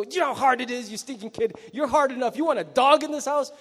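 hammed-up exasperated parental rant; scolding parent. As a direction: scold the stinking kid. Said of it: "we always go".

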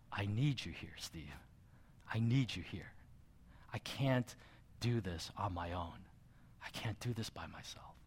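soft, pleading, fading to a hush; understated imitation of a crying wife; retold tearful plea. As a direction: gently voice a wife begging for help. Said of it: "and she was crying".

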